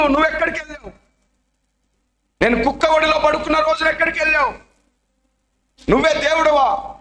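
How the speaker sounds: put-on kneeling boy tearfully reproaching God. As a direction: cry out your hurt to God. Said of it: "మోకరించి ఏడుస్తున్నాడు".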